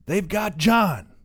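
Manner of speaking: put-on young man pleading. A narrator's reading. Young son protesting.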